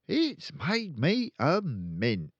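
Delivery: high, slow smug brag; slow proud boast. Acted. Father gloating over his profits, slow and smug. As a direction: savour every word of the boast. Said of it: "he added proudly".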